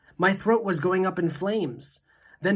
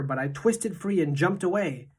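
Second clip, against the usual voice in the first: plain reading voice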